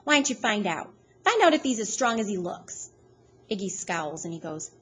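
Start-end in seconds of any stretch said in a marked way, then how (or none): 0.0-2.9 giggly voice. A narrator's reading. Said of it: "in this strange giggly voice she says".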